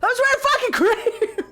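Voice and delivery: high, laughing cry of disbelief; laughing outraged yell. Retold or imitated. Still reliving the Glasgow goth accusation, laughing high yell.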